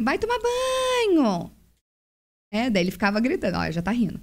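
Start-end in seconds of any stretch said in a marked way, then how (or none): 0.0-1.5 high, drawn-out yell to go bathe; much higher, slow mock shout; mock yell at kids. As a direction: call the kid to the shower, hammed-up. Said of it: "a gente fica assim".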